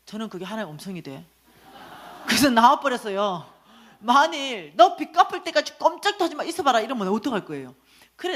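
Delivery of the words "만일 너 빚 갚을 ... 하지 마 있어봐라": higher and louder, menacing; angry threat. Retold or imitated. Boss threatening her until the debt is paid, angry. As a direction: warn her off in a rage.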